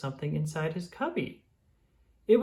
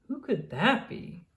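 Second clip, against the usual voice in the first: wondering aloud; 7 semitones higher, slower than usual. Acted. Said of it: "Daniel wondered aloud".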